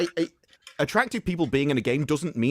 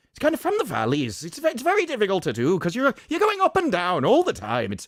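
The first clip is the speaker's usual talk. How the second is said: high, musical Welsh lilt from the valleys; attempted Welsh accent; 10 semitones higher, 3 dB louder than usual. Shown as a demonstration. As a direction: slip into sing-song Welsh, up and down, straying toward Irish. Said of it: "I even think then I did it".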